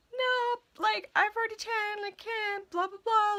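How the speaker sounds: high-pitched mock protest; protesting excuse. Clerk to a man who won't take any excuse, high protesting voice. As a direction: imitate yourself making excuses in a high voice. Repeating excuses to insistent customer.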